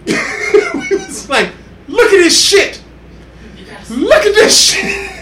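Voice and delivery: laughing through a retold exclamation; cracking up while quoting the crew; high, slow laughing disbelief. Laughing retelling watching the clip.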